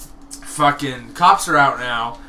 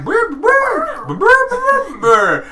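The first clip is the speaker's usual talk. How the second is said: squeaky puppet gibberish; 9 semitones higher, 8 dB louder than usual. Acted. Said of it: "And it's just like".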